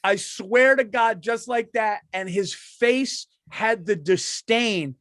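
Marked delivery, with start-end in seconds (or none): none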